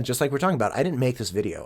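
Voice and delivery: ordinary delivery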